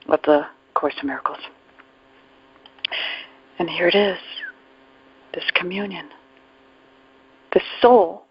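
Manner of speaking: ordinary talk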